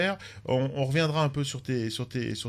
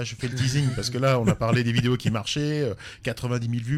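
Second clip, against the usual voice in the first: ordinary delivery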